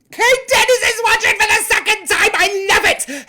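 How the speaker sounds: high and loud, thrilled; excited greeting. Real, not acted. Greeting a returning viewer, excited high loud welcome. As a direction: announce it like great news.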